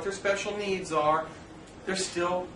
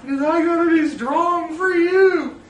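loud, high, slow declaration; retold outburst; 12 semitones higher, 11 dB louder, slower than usual. Husband answering wife why he hid crying, loud and slow. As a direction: belt it out like a confession.

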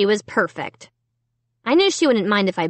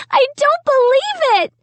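high, loud shriek of disbelief; 5 dB louder than usual. A narrator's reading. Sister over her ruined room, shrieking. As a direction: shriek it at the top of your range. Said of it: "Sarah kept shrieking".

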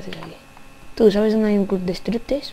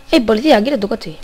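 ordinary delivery

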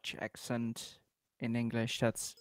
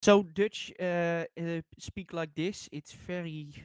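exaggerated Dutch accent in English; hammed-up Dutch-accented English; 3 semitones higher than usual. Shown as a demonstration. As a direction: put on a silly Dutch accent. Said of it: "I can do it for a second".

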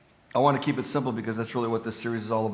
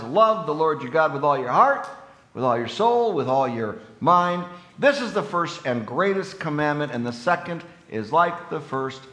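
solemn, slightly louder reading of Jesus' words; scripture reading; 2 semitones higher, 4 dB louder than usual. Acted. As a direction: proclaim the commandment with weight.

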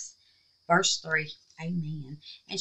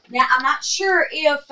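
usual speaking voice

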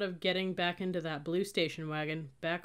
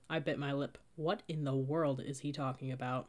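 plain reading voice

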